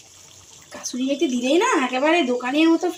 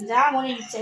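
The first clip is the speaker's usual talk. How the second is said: normal talking voice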